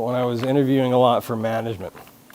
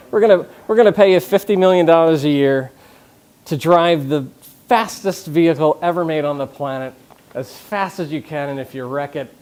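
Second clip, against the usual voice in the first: excited; 4 semitones higher, 7 dB louder than usual. Pretend. Mock team offer to driver.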